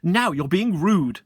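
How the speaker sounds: huffy schoolgirl; offended triplet. Acted. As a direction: scold him primly. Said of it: "said another triplet".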